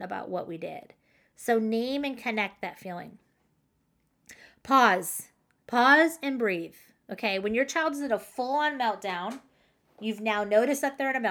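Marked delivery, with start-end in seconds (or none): 4.6-6.7 louder, punched "Pause" with a falling end; firm emphasis. Real, not acted.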